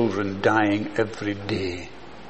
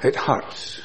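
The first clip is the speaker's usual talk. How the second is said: hushed it hurts; 6 dB quieter than usual. Real, not acted. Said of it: "when you have a compassionate heart".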